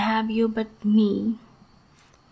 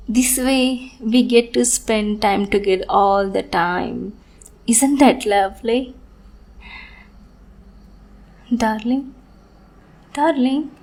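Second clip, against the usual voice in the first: sweet on the surface, unhinged underneath; obsessive sweet lover. Acted. Yandere lover's sweet monologue.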